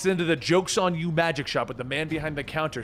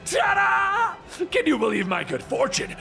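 high, theatrical villain crowing; gleeful Joker. A narrator's reading.

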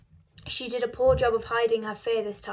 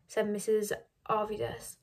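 ordinary delivery

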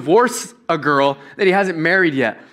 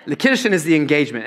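quick, rushed retake; said quicker; 3 semitones lower, faster than usual. Shown as a demonstration. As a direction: rattle off "The Kiddushin is the engagement" fast. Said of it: "I think you have to say it quicker than that".

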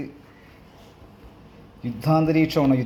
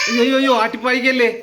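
hammed-up wailing please don't chase me; mock plea; 7 semitones higher than usual. Grandma begging the child not to chase her away, mock wail. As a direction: playfully beg the child for mercy. Adult pleads playfully with child.

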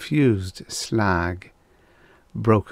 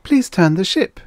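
higher and louder, eager plea; excitedly; 10 semitones higher, 6 dB louder than usual. A narrator's reading. Young woman begging the captain to turn, excited. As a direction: urge the captain on, breathless with eagerness. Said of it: "Nasha said excitedly".

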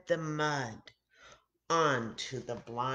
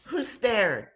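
calling out; 7 semitones higher, 7 dB louder than usual. Acted. Blind man calling out, unsure.

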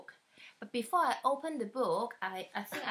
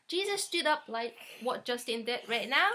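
ordinary delivery, nothing marked

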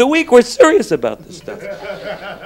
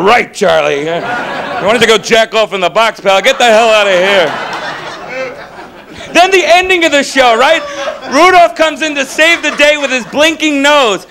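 usual speaking voice

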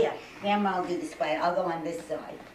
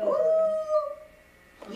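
excited coo; 3 dB louder than usual. Real, not acted. Cooing at the baby.

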